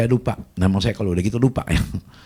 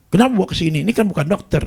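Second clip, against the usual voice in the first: angry scolding; 10 semitones higher, 5 dB louder, faster than usual. Retold or imitated. Husband keeps scolding wife.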